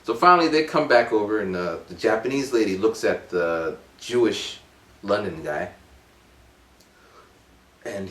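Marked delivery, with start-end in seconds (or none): none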